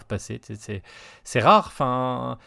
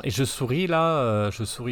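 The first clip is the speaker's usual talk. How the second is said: ordinary talk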